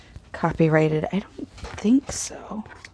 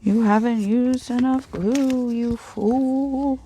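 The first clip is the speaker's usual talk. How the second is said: haunting voice; 5 semitones higher, slower than usual. Pretend. Mock ghost scolding herself.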